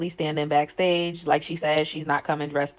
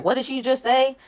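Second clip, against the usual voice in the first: confused disbelief; 8 semitones higher, 4 dB louder than usual. Retold or imitated. DTB acting confused on stage.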